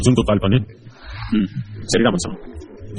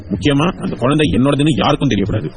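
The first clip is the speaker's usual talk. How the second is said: usual speaking voice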